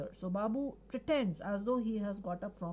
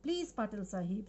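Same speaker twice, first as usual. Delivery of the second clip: normal talking voice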